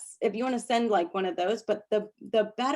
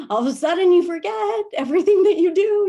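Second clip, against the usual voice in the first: excited; 11 semitones higher, 9 dB louder than usual. Real, not acted. Excited loud storytelling.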